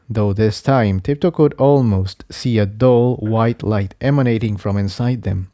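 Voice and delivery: plain reading voice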